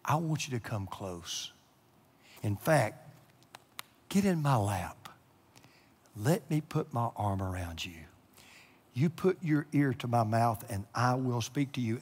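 low, soft, intimate invitation; God's gentle whisper. Acted. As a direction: draw them close with a hushed voice. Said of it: "God speaks to us in whispers".

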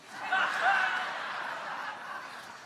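a big breathy burst of laughter; hearty laugh. Real, not acted. Laughing at own joke.

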